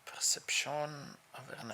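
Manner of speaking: "Perception awareness" with a mock French accent; half-whispered, lightly French. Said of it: "said that with a French accent".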